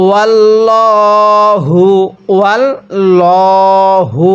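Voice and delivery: ওয়াল্লাহু with a heavy, full লা; heavy Allah. Shown as a demonstration. Teacher demonstrates the heavy Allah in ওয়াল্লাহু. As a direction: read ওয়াল্লাহু thick and full. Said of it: "আল্লাহ শব্দটাকে মোটা করে পড়ব".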